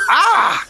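very high laughing cry; laughing shout. Real, not acted. Reader giving up amid the teasing, laughing shout. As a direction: burst out in a laughing yelp.

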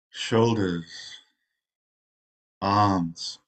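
unhurried meditation guide, long pauses between phrases; guided meditation. Real, not acted. Zen teacher settling meditators into rest, guided meditation voice. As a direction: ease them into rest, phrase by phrase.

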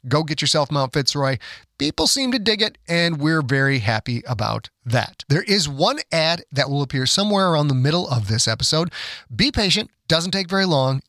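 ordinary delivery

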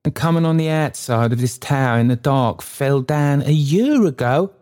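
put-on high, agitated old man in horror; Trotty. A narrator's reading. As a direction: cry it out, pitched well up. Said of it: "he cried, shuddering".

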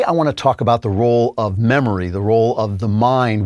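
ordinary talk, nothing marked

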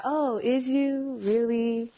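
drawn-out, doubting "really"; slow sarcastic drawl. Retold or imitated. To people boasting, a slow sarcastic doubting drawl. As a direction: stretch it out slowly, unconvinced. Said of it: "I'm like".

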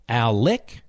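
"Al Lick" with "Lick" rising; stress on LICK. Shown as a demonstration. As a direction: punch "Lick" as its own word, pitch lifting. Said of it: "Is the emphasis on Lick?".

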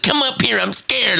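hammed-up frightened whine; mocking scared girlfriend. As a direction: tease her by squeaking out a scared plea. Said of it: "It's like".

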